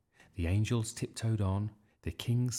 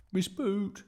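calling through door; 11 semitones higher than usual. A narrator's reading. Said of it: "said Bertie through the door".

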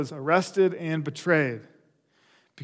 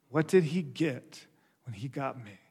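softer, lower, sobering question; hushed repeat; 3 semitones lower, 6 dB quieter than usual. Real, not acted. Preacher has congregation question themselves, hushed and reflective. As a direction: let the question sink in quietly.

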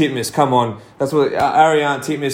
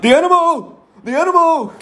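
Dean Boxall calling out. Retold or imitated. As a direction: imitate an excited coach hollering from the sideline. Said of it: "you know, calling out".

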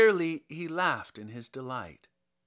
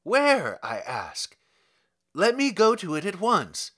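frantic demand; 4 semitones higher, 8 dB louder than usual. A narrator's reading. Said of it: "cried he".

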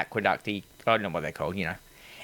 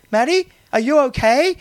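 much higher, louder call to a friend; mock call-out; 13 semitones higher, 5 dB louder than usual. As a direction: send it out to a missing friend, hammed up. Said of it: "turns around for no reason and goes".

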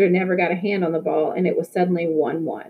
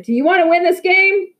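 loud, much higher rallying shout; 11 semitones higher, 5 dB louder than usual. A narrator's reading. Victor rallies the team in the huddle, loud rallying shout. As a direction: fire up the team with a big call. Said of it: "Victor shouted".

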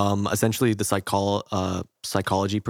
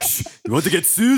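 high Scottish hushing; Willie; 8 semitones higher, 3 dB louder than usual. Acted. Groundskeeper hushing Bart about lawsuits, mock Scottish. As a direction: shush him in cartoon Scottish. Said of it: "it's Willie, and he talks to Bart".

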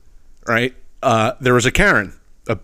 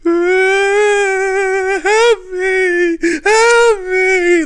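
very high crying mock plea for help; mock Karen victim wail; 5 dB louder, much slower than usual. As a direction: ham up a fake victim's whine. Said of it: "You hear her go".

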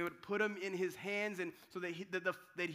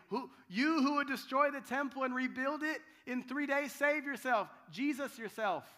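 higher, louder taunting jeer; mocking passerby; 5 semitones higher, 5 dB louder than usual. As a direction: sneer at him like a passing mocker. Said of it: "Those who passed by would deride him".

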